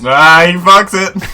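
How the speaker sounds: loud, high crow at a slip; big rising, mocking holler; gleeful jeer. Real, not acted. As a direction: shout it out like a commentator calling a blunder. Co-host crows at the reader's slip.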